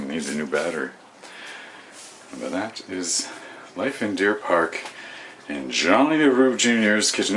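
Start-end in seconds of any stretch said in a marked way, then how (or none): none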